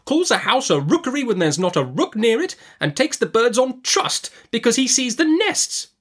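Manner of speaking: very high, exasperated aunt; scornful Miss Betsy. A narrator's reading. Aunt mocks the rookery without rooks, scornful. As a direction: deride his folly in a high voice.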